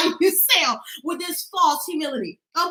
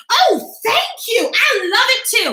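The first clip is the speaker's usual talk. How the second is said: 1 confident thank you; 7 semitones higher, slower than usual. Shown as a demonstration. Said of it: "You know what you say?".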